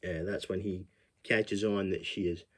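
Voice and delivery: ordinary delivery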